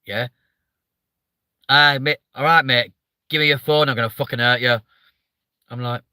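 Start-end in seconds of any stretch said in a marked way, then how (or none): 1.7-4.8 friendly northern English mugging; northern English accent. Retold or imitated. Streamer imitating a lovely northern robber, northern accent. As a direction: rob them nicely in a northern English accent. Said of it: "northern people can make anything sound lovely".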